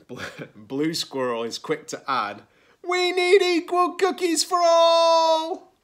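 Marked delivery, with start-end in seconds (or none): 2.8-5.7 high, loud, drawn-out demand; outraged cry. A narrator's reading. Blue Squirrel demands fair shares, outraged cry. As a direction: proclaim it at full voice, stretched out.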